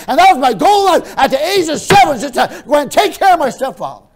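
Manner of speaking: high, very loud preacher's shout; vehement, high-pitched outcry; full-force angry preaching. Real, not acted. Preacher at full force, recalling hatred.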